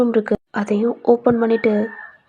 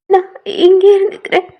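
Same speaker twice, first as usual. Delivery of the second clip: pleading; 9 semitones higher, 6 dB louder than usual. Acted. Heroine refuses to go to room.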